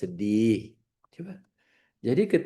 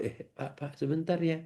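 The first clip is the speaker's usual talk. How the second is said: hushed; 3 semitones higher, 9 dB quieter than usual. Acted. Acted reply, hushed.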